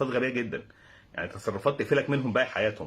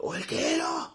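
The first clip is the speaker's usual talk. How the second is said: very high hissed question; high-pitched serpent voice; snake hiss; much slower than usual. Retold or imitated. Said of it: "بنفس الفحيح".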